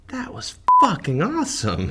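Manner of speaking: comic realizes the night's win, thrilled; giddy delight. Retold or imitated. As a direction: let the thrill break out. Sudden glee after the cash.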